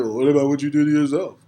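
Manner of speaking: hammed-up imitation of a critic; mocking a critic. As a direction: parody the critic's comeback. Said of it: "Don't try to counter it".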